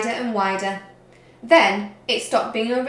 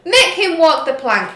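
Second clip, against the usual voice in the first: loud, higher demanding yell; 6 semitones higher, 9 dB louder than usual. A narrator's reading. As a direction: call for the plank with glee. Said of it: "yelled Ben Buckle and Percy Patch".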